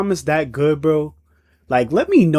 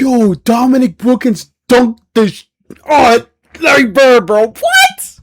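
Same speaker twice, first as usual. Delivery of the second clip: loud, higher, excited outcry; hyped reaction; 7 semitones higher, 6 dB louder than usual. Real, not acted. Hyped viewer reacting to the dunk replay, loud. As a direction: erupt over the dunk.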